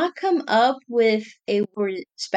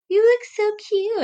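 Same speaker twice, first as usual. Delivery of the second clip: high-pitched imitation gush; mock-adoring squeal of a compliment; gushing admirer; 12 semitones higher, 3 dB louder than usual. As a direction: coo over someone cute, much higher. Admirer gushing over the dress uniform.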